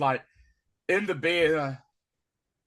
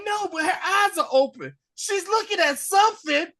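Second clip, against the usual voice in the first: high, loud desperate insisting; cling to hope, voice up high; desperate protest; 9 dB louder than usual. Retold or imitated. Son pleading with the nurse that his mother is still there, desperate high protest. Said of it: "And he's like".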